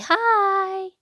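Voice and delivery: high, loud, bright greeting call; excited children calling hi; cheery hi. Acted. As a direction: sing out a big friendly hello. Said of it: "We all say".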